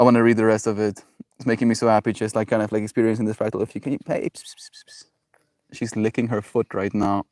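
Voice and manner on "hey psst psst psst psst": soft hissed "psst psst" attention call; psst to a pet. Real, not acted. Owner getting a foot-licking pet's attention, soft repeated psst. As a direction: whisper a quick string of pssts to catch attention.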